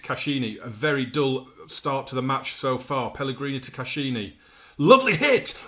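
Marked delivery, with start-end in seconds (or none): 4.8-5.6 sudden higher, louder cry of delight; excited shout. Real, not acted. Watching his striker's shot fly close, excited cry. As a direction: leap up at the shot, thrilled.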